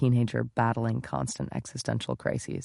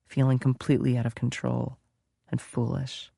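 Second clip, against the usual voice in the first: ordinary delivery, nothing marked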